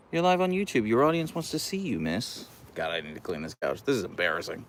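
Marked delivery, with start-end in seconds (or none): 0.1-2.4 higher, cooing voice to the dog; high pet talk. Acted. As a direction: coo at the dog in a raised voice. Still talking to the dog.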